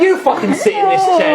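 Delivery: high, loud sweary outburst; mock furious tirade. Host laying into Tom over the name, mock furious. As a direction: tear into him, half laughing.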